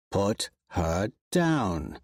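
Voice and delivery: slow, low flat order; stern command. Acted. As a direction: lay down the law slowly. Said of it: "Darrow orders".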